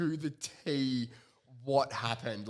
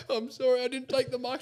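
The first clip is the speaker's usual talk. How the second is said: high, louder, laughing apology; imitating crying player; mock tearful apology; 10 semitones higher, 4 dB louder than usual. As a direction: whine the apology. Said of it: "he's like".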